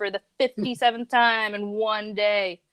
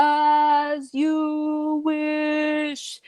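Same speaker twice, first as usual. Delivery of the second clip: movie quote; 7 semitones higher, much slower than usual. Retold or imitated. Princess Bride quote voiced.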